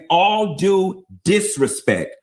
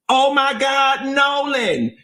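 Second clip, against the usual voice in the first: mock scream; 11 semitones higher, slower than usual. Host questioning why they screamed, mock scream. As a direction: retell a scream on a raised, stretched pitch. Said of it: "why are you screaming".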